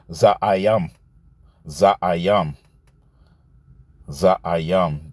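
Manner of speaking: za'ayam said slowly as "zah-ah-YAHM", low and deliberate; careful Hebrew pronunciation of za'ayam; Hebrew word said out. Shown as a demonstration. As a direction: sound out za'ayam syllable by syllable. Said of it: "And the Hebrew word is".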